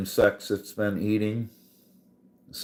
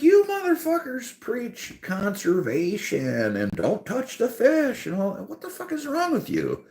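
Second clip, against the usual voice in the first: high exasperated rant; 13 semitones higher than usual. Retold or imitated. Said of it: "And I'm like".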